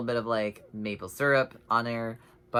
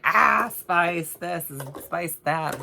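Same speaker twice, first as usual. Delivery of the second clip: higher, louder, slow sing-song whine; hammed-up whiny complaint; mock complaining; 7 semitones higher, 8 dB louder, slower than usual. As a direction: parody your own constant griping about spice. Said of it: "I'm always like".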